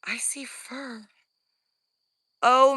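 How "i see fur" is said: hushed whisper so it won't hear. Real, not acted. Filming a creature in the woods, tense whisper. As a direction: breathe the words out, no voice.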